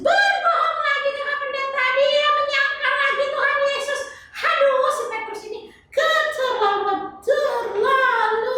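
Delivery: put-on high, sing-song little girl voice; chirpy kid puppet answering the pastor; high child puppet voice. Tiur scolds Peter, laughing.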